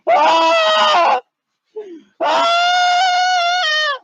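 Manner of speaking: long, very high held scream; high-pitched scream. Pretend. Scared searcher hunting for Bigfoot, piercing drawn-out scream. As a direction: shriek in hammed-up terror. Mock terror on the dog search.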